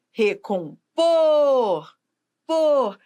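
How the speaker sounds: slow recompor, POR stretched long, then POR alone; stress on POR. Shown as a demonstration. Teacher proving which syllable rules, long emphatic por in recompor. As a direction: break up recompor and lean hard on por. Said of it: "é a sílaba que manda na palavra".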